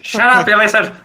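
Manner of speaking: high mock shout of a cranky old man. Player as fearless Will to the dragon, cranky mock yell. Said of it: "the old man who keeps, he keeps shouting".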